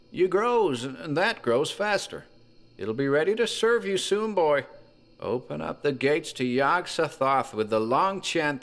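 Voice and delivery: put-on high, strained old voice; grandfather's last urgent orders to his grandson; dying old man. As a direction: squeeze the words out like a dying grandfather. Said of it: "choke out a few words to his grandson".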